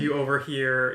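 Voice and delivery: normal talking voice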